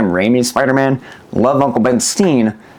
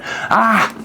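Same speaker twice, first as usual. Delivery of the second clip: high-pitched "ah" of real overwhelm; emotional outcry; 11 semitones higher than usual. Real, not acted. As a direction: let out a high, emotional cry. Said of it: "I'm getting emotional".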